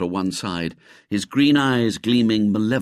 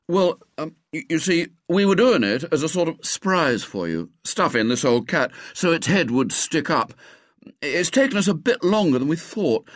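put-on higher, hesitant, stumbling boy; lightly voiced flustered boy; 7 semitones higher than usual. A narrator's reading. William caught by Miss Carroll, flustered boy voice. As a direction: fumble an excuse.

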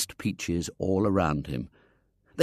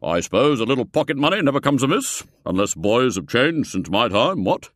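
put-on loud, jolly old buffer; hearty old gentleman; 3 semitones higher, 4 dB louder than usual. A narrator's reading. Foulard handing William coins, loud and hearty. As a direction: boom it out like a cheery colonel type. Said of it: "saying heartily".

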